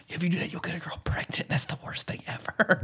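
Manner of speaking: hushed, fast warning whisper. Retold or imitated. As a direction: whisper it like a dirty secret. Mock warning from school talk.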